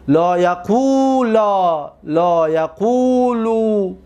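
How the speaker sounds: slow recitation with long held vowels; paradigm recitation. Shown as a demonstration. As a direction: stretch each long vowel evenly. Teacher recites the verb paradigm.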